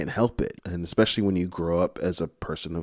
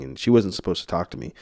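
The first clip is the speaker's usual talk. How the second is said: normal talking voice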